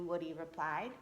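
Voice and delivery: ordinary delivery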